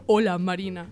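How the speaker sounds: imitated shout. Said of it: "me gritó".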